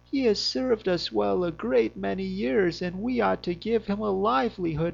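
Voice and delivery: pleading wife voice. Acted. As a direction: beg softly for the old dog's life.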